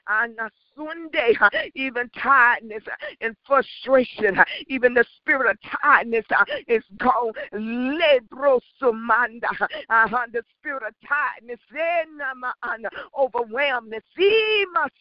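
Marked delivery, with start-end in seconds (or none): none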